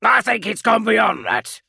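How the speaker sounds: loud, high, relishing. Acted. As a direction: savour the accusation. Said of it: "said Zancator with relish".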